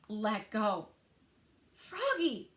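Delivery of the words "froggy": higher alarmed call of the name; yell. A narrator's reading. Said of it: "he yelled".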